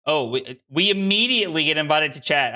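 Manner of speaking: ordinary delivery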